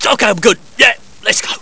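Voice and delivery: ordinary delivery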